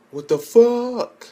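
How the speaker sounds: high, slow, stretched out in disbelief; stunned high drawn-out outcry; shocked squeal. Real, not acted. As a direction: squeal it out at the twist. Disbelief at plot twist.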